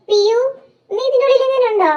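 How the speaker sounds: calling out. Acted. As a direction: call for someone out of sight. Calling out while searching for Piyu.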